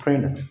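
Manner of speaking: "प्रिंटर" as "prinner"; American-style "प्रिंटर" with only the N; American silent T. Shown as a demonstration. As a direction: drop the T after N in "प्रिंटर". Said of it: "इस टी को silent कर देते हैं".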